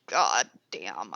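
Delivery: usual speaking voice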